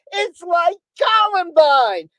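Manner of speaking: loud, high, drawn-out mocking quote; mock parroting at a fed-up shout; exasperated mimicking. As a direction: shout it slowly, imitating people who keep saying it. Exasperated quote of what people say.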